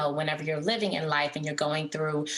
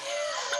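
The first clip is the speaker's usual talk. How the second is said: short airy laugh, mostly breath; breathy high laugh. Real, not acted. A guest laughs when asked if she's a Longhorn fan. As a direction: laugh off the football question.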